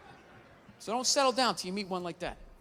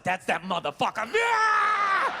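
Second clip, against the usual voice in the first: dog spotting a target, shouted; dog lunging at a stranger, hammed-up aggressive shout; 9 semitones higher, 4 dB louder, much slower than usual. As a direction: growl it out, then a higher, louder yell. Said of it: "the dog's like".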